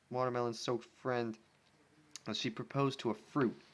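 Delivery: ordinary delivery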